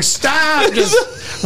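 high-pitched mock yell of protest; hammed-up high yelp; retold yell. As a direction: cry out as if pinned down. Retold yell while pinned down.

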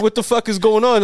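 high, incredulous outburst; mock disbelief. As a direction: act baffled and indignant. Retold reaction to kid staring.

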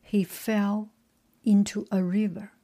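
slow, measured storybook reading; story narration. A narrator's reading. Reader telling the myth of Phaeton, measured story narration. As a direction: read it out phrase by phrase, with pauses.

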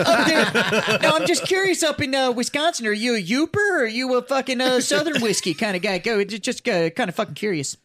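high, hammed-up Wisconsin voice; Wisconsin accent. Shown as a demonstration. Podcaster performing on his friends' request, mock Wisconsin accent. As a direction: put on a Yooper-style Wisconsin accent, pitched well up. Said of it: "Or your Wisconsin".

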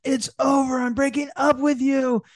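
high, hammed-up breakup cry; dramatic overreaction. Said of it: "don't overreact and say".

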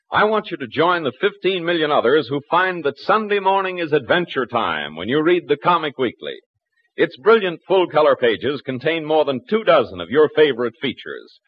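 normal talking voice

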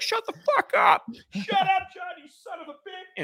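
high, far-off mock neighbor yelling; angry neighbor. Neighbor yelling at the fan over the noise, mock angry. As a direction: play the neighbor yelling through the wall. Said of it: "The neighbor's like".

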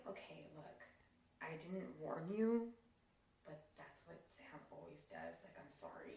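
hushed confidential warning; hushed aside. Retold or imitated. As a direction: murmur a quiet warning so no one hears. Sister's hushed aside to husband.

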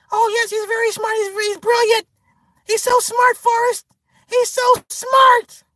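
high-pitched put-on woman's voice, gushing; mock Jenny. Jenny assuring Forrest about their son, gushing falsetto. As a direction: gush in a squeaky high voice, stretching the last praise.

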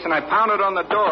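ordinary talk, nothing marked

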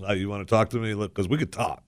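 low, soft, harmless-sounding quote; lawyerly understatement of a challenge; innocent retelling. Retold or imitated. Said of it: "all you said was".

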